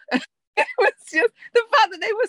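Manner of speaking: high laugh breaking through the words. Real, not acted. Host recalls the video, laughing through the words. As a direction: talk through helpless laughter. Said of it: "crying with laughter".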